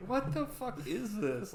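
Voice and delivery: high, drawn-out incredulous question; baffled disbelief. Retold or imitated. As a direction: retell your own stunned reaction, slowly. Retells own baffled reaction to sketch.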